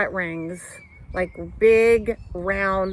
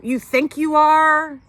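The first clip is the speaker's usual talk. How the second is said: loud, high disbelieving echo; mock interviewer grilling her; incredulous challenge; 7 semitones higher, 7 dB louder than usual. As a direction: throw it back at her in disbelief.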